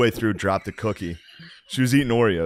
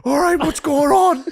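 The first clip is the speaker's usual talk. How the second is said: very high, louder imitated fluster, laughing; a drunk father scrambling in panic; flustered drunk dad; 6 dB louder than usual. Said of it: "He was like".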